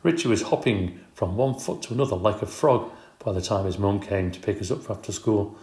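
usual speaking voice

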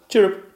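very high, loud cricket chirp. Acted. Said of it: "the sound coming from a cricket".

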